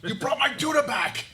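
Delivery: very high, loud yell from a tower; hammed-up excited holler; voice calling from above. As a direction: shout down in amazed delight. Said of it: "a voice calls out from above".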